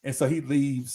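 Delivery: ordinary talk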